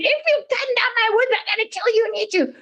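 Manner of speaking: high, screaming troll threat; roaring troll. A narrator's reading. Huge troll threatens the youngest brother, screaming and roaring. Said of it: "screaming and roaring".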